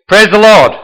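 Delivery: high, loud shout; shouted command. Acted. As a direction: call it out to start the donkey. Rider commanding his donkey to go.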